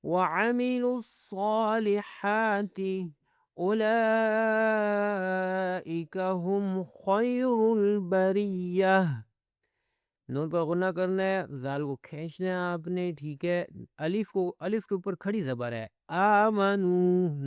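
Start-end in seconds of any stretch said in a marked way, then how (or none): 0.0-9.0 slow, drawn-out tajweed chant; measured teaching recitation, slightly raised pitch; Quran recitation. Shown as a demonstration. 16.1-17.4 آمنو with the long vowels held; slow tajweed reading of آمنو; elongated recitation. Shown as a demonstration. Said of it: "نون کو بھی کھینچنا ہے".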